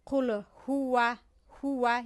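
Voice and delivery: slow "هو" as huwa with a rounded w; drawn-out Arabic w in "قل هو"; lips pushed out. Shown as a demonstration.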